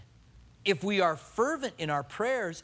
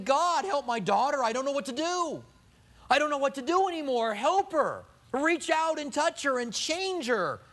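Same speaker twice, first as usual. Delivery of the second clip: higher, urgent cry for help; earnest, raised plea to God; fervent pleading; 8 semitones higher than usual. Pretend. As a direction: beg God for your daughter with feeling. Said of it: "Calling out to him with a fervency".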